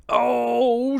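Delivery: loud, high-pitched oh; high exclamation. Real, not acted. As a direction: let out a high, loud cry. Exclaims while hunting for link.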